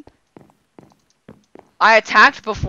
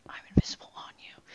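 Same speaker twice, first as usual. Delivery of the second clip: quick mocking whisper; teasing whisper; 14 dB quieter, faster than usual. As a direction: whisper it back fast, teasing. Echoes friend's whisper teasingly.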